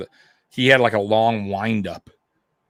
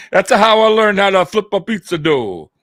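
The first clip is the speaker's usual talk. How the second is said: hammed-up Italian pizza man; high, loud Italian pizzeria voice; Italian accent; 8 semitones higher, 4 dB louder than usual. Pizza shop joke in accent.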